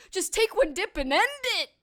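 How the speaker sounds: high, loud exasperated demand; hammed-up George scold. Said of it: "I love when he says".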